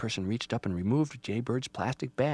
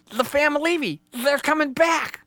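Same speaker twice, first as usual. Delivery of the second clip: high, loud, drawn-out warning; alarmed Rabbit; 9 dB louder than usual. Acted. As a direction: cry the news like a startled bunny.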